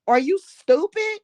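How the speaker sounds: shrill high exclamation, suddenly sharp; exasperated outburst. Real, not acted. Scolding the people who lied, a sharp exasperated cry. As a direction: throw the question at them in disbelief.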